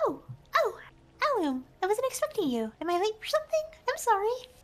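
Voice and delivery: high, fast, flustered bird voice; a nervous, fluttery avian; flustered bird character. Acted. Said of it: "he says".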